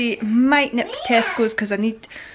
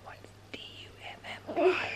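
spelled out in a whisper; 8 semitones higher, 15 dB quieter than usual. Real, not acted. Whispered spelling to avoid baby.

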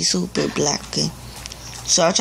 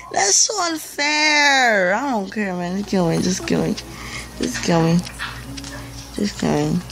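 sulky whine, repeated and petulant; 3 semitones lower, 3 dB quieter than usual. Real, not acted. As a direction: whine like a sore loser. Whining after losing.

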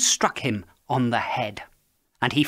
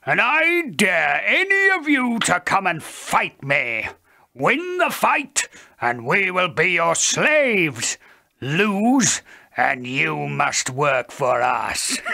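loud, higher, slowing giant voice; boastful giant; 5 semitones higher, 5 dB louder, slower than usual. A narrator's reading. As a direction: taunt the whole army. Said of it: "he bellowed".

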